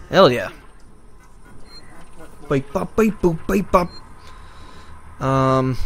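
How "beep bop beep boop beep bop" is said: computer beeps. Pretend. Mimicking computer noises while hacking.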